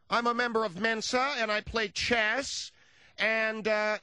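high-pitched know-it-all lady; Mensa lady voice. Retold or imitated. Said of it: "that Mensa member".

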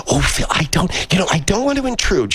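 put-on high-pitched, apologetic older lady; high voice of a hesitant lady caller; older female character. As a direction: put on a high old woman's voice, timidly imposing. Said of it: "an older female character who will go".

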